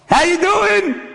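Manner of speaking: high, drawn-out hail; acted high-pitched call. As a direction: sing out a greeting at the door. Calling out at the door.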